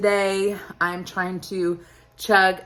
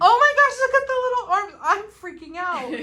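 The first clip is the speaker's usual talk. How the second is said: real high-pitched squeal of joy; excited squeal; 6 dB louder than usual. Real, not acted. Watching baby move on screen.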